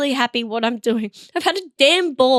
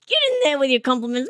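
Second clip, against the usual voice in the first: ordinary delivery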